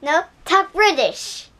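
loud, high-pitched order; demanding shout. Real, not acted. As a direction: shout the demand over everyone. Child loudly demands another accent.

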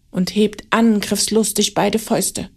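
plain reading voice

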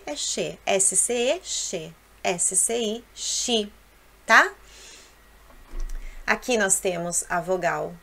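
ordinary talk

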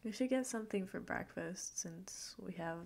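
usual speaking voice

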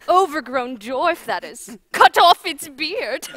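put-on laughing scornfully at the boy; mocking laughter. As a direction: sneer through the laugh. Queen mocks the boy.

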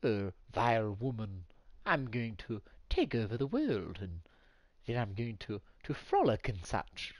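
deep, low cartoon villain voice; Stewie Griffin. Shown as a demonstration. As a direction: drop low and declare it like a pompous little schemer. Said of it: "this is my deep Stewie voice".

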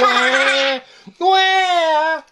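high hammed-up wah wah; mock baby crying. Mom teasing her child, mock crying wah wah. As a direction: tease her with fake baby wails. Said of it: "She's going".

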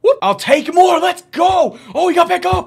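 real excited shout; excited cheering. Real, not acted. Runner gains extra yards.

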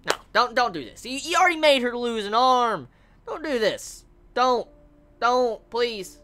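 high, begging don'ts; pleading. Real, not acted. Fan begging the anime to spare Izana, pleading high. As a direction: plead with the story. Begging the show not to kill character.

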